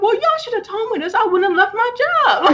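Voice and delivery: high, laughing indignation; indignant. Retold or imitated. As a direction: complain with a disbelieving laugh. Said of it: "I was like".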